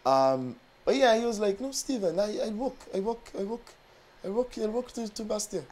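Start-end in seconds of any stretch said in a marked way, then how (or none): 1.6-5.6 softer, higher imitation of a German client; soft, insistent German accent, pitched up. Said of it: "That's the best German accent".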